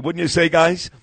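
ordinary talk

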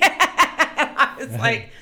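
high, very slow and laughing; cracking up mid-story. Real, not acted. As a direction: let the laugh stretch the words. Laughing while telling the story.

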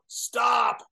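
sharp mock yell to halt a crowd; retold shout. Terry Von Erich yelling at rioting fans to stop, loud shout. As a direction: bark it like stopping a riot.

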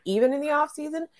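disbelieving, rising question; asking in mild disbelief; incredulous question. Retold or imitated. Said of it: "People keep asking me".

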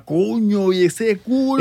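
mock dad admiring the video; imitating his dad. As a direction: leer like an impressed dad. Dad quoted reacting to video.

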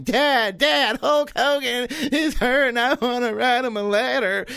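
put-on a whiny little boy begging his father; kid pleading to dad. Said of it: "Okay, I was crying".

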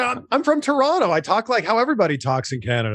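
high-pitched retold protest of a Canadian; indignant protest. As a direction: protest in disbelief, starting high then settling. Retelling own protest to producer.

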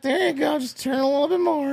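put-on high-pitched leering old man; mock creepy old neighbor voice. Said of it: "he's like".

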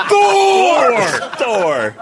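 loud laughing shout. Real, not acted. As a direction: yell the name out. Shouting name during story.

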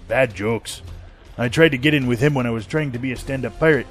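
usual speaking voice

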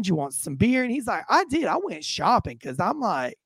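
theatrical storytelling with swooping pitch; nonchalant suspect. Retold or imitated. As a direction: imitate an unbothered man explaining himself to police. Plays suspect telling his side.

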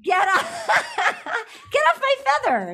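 laughing through a high echo of the line; laughing repeat. Acted. Repeating friend's quip, laughing high. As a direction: crack up while repeating it.

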